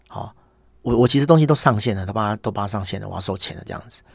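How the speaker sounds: ordinary talk, nothing marked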